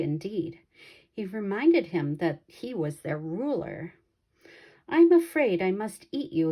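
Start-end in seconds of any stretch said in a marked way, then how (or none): 4.9-6.5 a touch of a menacing lion, a bit higher and louder; lightly voiced lion, politely threatening the monkeys; lion explaining. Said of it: "he explained".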